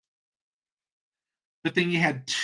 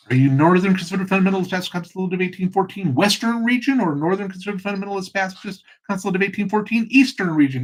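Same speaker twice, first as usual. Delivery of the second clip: fast, higher-pitched comic rattle; rapid-fire question; 5 semitones higher, faster than usual. Acted. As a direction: race through the absurdly long title. Rattles off absurd denomination name.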